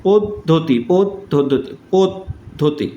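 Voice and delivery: পদ্ধতি split as pod, dhoti; pronunciation demo. Shown as a demonstration. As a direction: repeat পদ্ধতি with a break after the first syllable. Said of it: "উচ্চারণটা এরকম".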